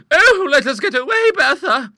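very high, loud plea to flee; shrinking lady's high-pitched wail; frightened cry. A narrator's reading. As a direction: plead in horror, voice shooting up. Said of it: "cried Monica".

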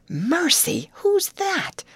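high, forceful stage whisper; whispered alarm. A narrator's reading. Girl startled by a strange old man, whispering. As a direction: whisper it urgently, pitched right up. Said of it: "whispered Molly".

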